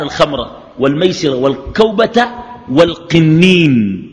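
very slow, elongated quotation; drawn-out recitation. Retold or imitated. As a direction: stretch each listed word out slowly. Reciting a hadith, word by word.

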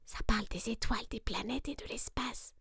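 soft whisper full of wonder; hushed rabbit whisper. Acted. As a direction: share a secret about space. Letter about stars.

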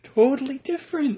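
squeaky high; a hammed-up outraged squeak; mock indignant. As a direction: friends bickering over moan versus groan.

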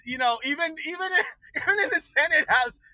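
usual speaking voice

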